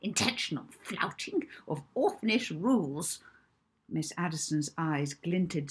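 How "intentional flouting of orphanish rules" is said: spiteful matron. Acted. Miss Addiston scolding.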